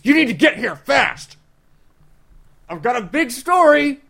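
high, loud urgent voice; urgent caller. Acted. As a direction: push it like breaking news. Character urgently phones Biff.

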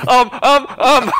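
high, loud mock stammering ums; Sam. Game master mimics a player at a loss, mock hesitation. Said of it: "as Sam's like".

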